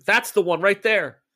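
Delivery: half-voiced scream from the narrator; mild narrated scream. A narrator's reading. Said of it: "Ella is screaming".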